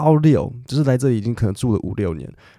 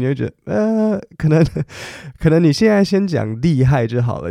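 usual speaking voice

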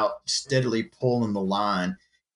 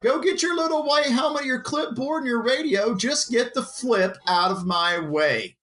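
mocking; 12 semitones higher than usual. Mocking the crewman, high voice.

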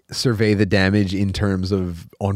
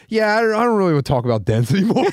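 higher, fed-up retelling of the dent guy; annoyed guy; 9 semitones higher than usual. Retold or imitated. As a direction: mimic a tired guy brushing you off.

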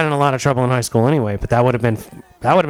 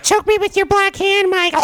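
very high mock-female voice; put-on woman's voice. Imagined actress begging Mike, high put-on voice. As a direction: squeal it as a swooning fan fantasy.

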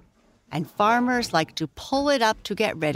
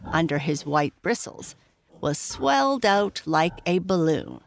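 ordinary delivery, nothing marked

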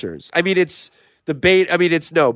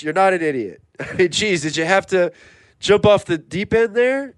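ordinary delivery

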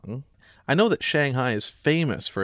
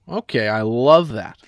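ordinary talk, nothing marked

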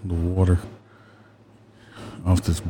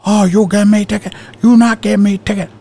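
squeaky, much higher mock Middle Eastern accent; 12 semitones higher, 3 dB louder than usual. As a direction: whine it like the driver in a put-on Middle Eastern accent. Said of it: "He had a heavy accent".

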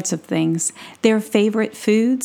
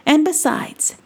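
high, excitable little gnome voice; excitable Brisbane; 4 semitones higher than usual. Acted. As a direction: squeak it out like an eager tiny adventurer. Brisbane explains his freedom.

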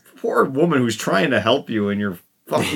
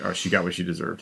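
low, soft, offhand dismissal; dismissive; 6 semitones lower, 8 dB quieter, faster than usual. Retold or imitated. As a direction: brush it off coldly. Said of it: "he dismisses".

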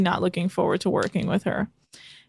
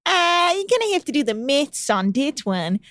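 put-on New Zealand accent; 4 semitones higher, 4 dB louder than usual. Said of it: "try and redo the accent".

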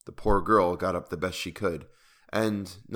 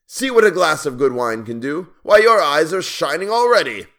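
high, loud, swooping exclamation; roaring, gloating king; 8 semitones higher, 9 dB louder than usual. A narrator's reading. King mocking the dwarf's drinking, loud gloating. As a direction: crow it out over your victim.

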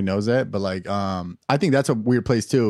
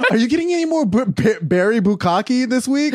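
mock customer; 10 semitones higher than usual. Mock customer asking the yogurt clerk, laughing. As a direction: play a sweet customer asking for a flavor.

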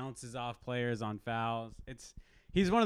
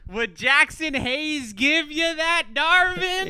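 high, slow mock Southern drawl; exaggerated Southern accent, heated; 12 dB louder, slower than usual. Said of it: "I slipped into Southern voice 'cause I got all, all mad".

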